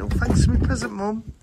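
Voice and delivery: put-on high, sweet polite boy voice; high innocent child thanking his mum; sweet polite kid. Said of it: "The roadman accent goes out the window".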